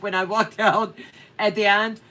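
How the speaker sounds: ordinary talk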